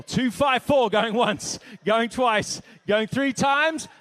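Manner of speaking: loud, rapid-fire auction call; auctioneer calling bids. Acted. Auctioneer at a charity gala, calling out bids loudly.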